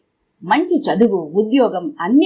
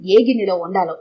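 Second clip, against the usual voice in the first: ordinary delivery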